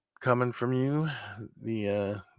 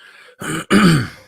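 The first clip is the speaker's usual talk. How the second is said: clearing throat; 7 semitones higher, 10 dB louder than usual. Real, not acted.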